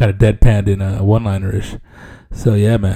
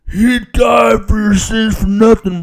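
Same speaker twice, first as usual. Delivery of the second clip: mock preaching shout; 9 semitones higher, 3 dB louder, slower than usual.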